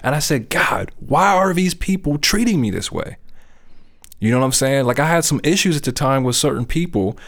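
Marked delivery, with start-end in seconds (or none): 0.5-3.2 raised, frustrated plea to God; mock angry cry, pitched up high; angry outcry. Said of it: "I'm angry".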